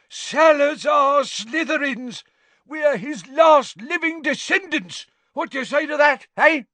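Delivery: loud, high boastful yell. A narrator's reading. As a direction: keep bellowing with pride. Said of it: "yelled Gaunt".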